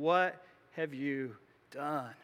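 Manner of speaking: slow, hushed, heavy accusing question; grave prophet's rebuke. Retold or imitated. Samuel cutting straight to the chase with Saul, slow grave rebuke. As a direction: let the weight of the rebuke sink in. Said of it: "Samuel asks that question".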